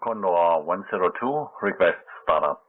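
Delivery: pilot over a crackly radio; air traffic control radio. Real, not acted. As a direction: call it in like a pilot.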